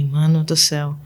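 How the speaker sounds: hushed whisper under her breath; embarrassed whisper. Retold or imitated. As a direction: whisper so nobody in the pew hears. Embarrassed aside during silent mass. (sussurro envergonhado)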